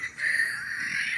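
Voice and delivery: voiceless wheeze of laughter; real breathless laughter; wheezing laugh. Real, not acted. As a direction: laugh too hard to get words out. Helpless laughter before punchline.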